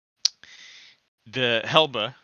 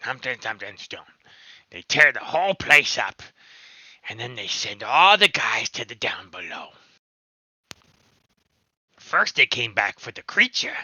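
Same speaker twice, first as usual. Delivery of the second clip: goblin voice; 5 dB louder than usual. Acted. Goblin explaining.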